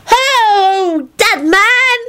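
high-pitched, gleeful calling out; happy holler. A narrator's reading. Girl hailing the floating dead man, happy holler. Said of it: "Natalie hollered happily".

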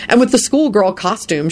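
usual speaking voice

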